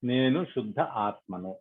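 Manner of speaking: measured affirmations with long silences; guided meditation prayer. Acted. Leader voicing affirmations for meditators to repeat, calm and prayerful. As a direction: lead them phrase by phrase, leaving space to repeat.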